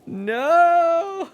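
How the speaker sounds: high drawn-out wail. Real, not acted. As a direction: wail at the sky. Rain starts in game.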